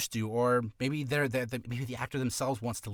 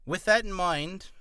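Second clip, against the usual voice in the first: ordinary talk, nothing marked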